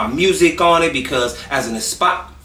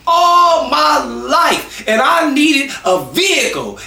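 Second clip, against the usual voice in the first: excited shout; 11 semitones higher, slower than usual. Real, not acted. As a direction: shout it, finally free of the bus. Tired of catching the bus.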